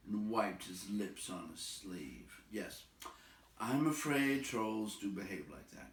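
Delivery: usual speaking voice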